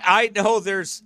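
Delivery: usual speaking voice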